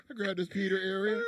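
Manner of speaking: high, loud mocking imitation; mocking girly voice. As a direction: parrot her words in a high, loud voice. Mocking Nakia's words.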